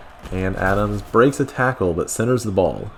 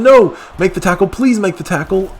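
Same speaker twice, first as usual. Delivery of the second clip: high, loud pleading shout; desperate yell; 12 semitones higher, 7 dB louder than usual. Real, not acted. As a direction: beg the defense out loud, much higher. Pleading as defense fails.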